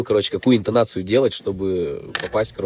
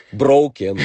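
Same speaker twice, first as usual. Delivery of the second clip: broken blurted louder and higher, falling hard; mock bad attempt; 4 semitones higher, 9 dB louder than usual. Rapper retells his clumsy first try at broken. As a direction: overdo the word broken like an eager student. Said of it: "Я такой".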